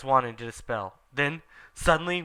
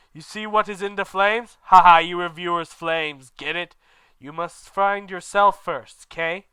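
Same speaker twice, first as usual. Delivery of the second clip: a raised, lightly voiced old wizard joking; Dumbledore teasing; 4 semitones higher, 7 dB louder than usual. Dumbledore teasing the reviewers, lightly voiced and raised. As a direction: lightly play the smug headmaster. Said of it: "Dumbledore said".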